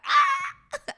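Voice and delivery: a very high, loud squeal of delight. Real, not acted. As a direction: let out a thrilled shriek, way up high.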